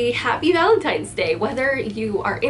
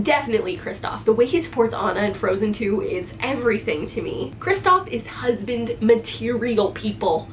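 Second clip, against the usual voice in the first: ordinary delivery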